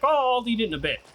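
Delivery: a mock brother too busy to eat; imitating brother. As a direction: wave off the food casually. Imitating brother refusing lunch.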